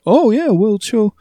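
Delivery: high-pitched, put-on reasoning; mock bad-faith argument. Co-host parodying a bad-faith take on representation, mockingly high. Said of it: "It's that kinda thing of".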